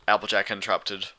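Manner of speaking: plain reading voice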